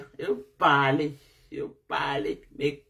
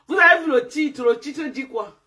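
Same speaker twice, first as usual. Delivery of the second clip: exasperated call; 8 semitones higher, 5 dB louder than usual. Real, not acted. Gronde le public sur le titre.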